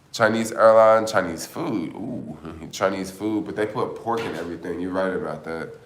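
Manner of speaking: ordinary talk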